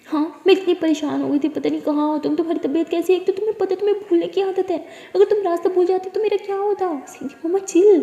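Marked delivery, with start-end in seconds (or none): 0.1-6.9 high, louder worried outpouring; mother hugging her daughter, high and frantic; emotional mother. Acted. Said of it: "बहुत ही ज़्यादा emotional हो जाती है". 7.5-8.0 high, loud brushing off; loud protest. Acted. Daughter brushing off mother.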